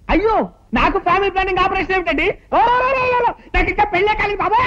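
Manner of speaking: high, frantic wailing protest; panicked protest. Acted. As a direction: cry out in comic panic to stop the doctor. Panicking patient refuses the operation. (భయంతో కేకలు పెడుతూ)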